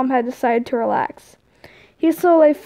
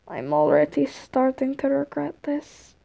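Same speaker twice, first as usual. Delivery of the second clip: softer, weary groan; 5 dB quieter than usual. A narrator's reading. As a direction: grumble it under your breath. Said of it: "Tord groaned".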